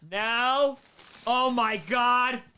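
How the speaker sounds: high, loud, slow exclamation; thrilled, drawn-out outburst; awestruck delight. Real, not acted. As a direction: stretch it out in amazement. Delighted by gift.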